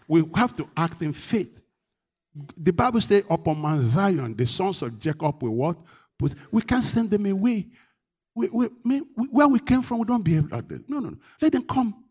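high, loud, fervent preaching; full-force preaching. Real, not acted. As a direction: raise the voice and plead with the whole church.

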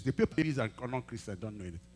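usual speaking voice

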